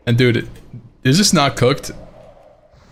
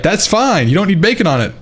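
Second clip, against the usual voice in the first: higher-pitched, swooping waitress imitation; imitated waitress; 8 semitones higher than usual. Waitress accepting a no-bacon order, imitated in a higher voice. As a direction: play the accommodating waitress, pitched up.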